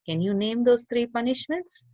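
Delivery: ordinary delivery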